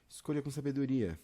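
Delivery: ordinary talk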